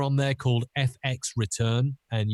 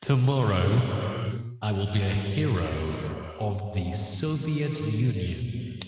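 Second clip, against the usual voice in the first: Bond villain. Acted. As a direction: proclaim it grandly, with pauses. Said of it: "as if he was a Bond villain".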